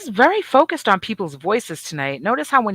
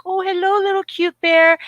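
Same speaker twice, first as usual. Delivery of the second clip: put-on high, gushing baby-talk coo; sweet talk to a tiny animal; 13 semitones higher, 5 dB louder than usual. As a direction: coo at the little bear cub like it's a puppy. Greeting a cute bear cub.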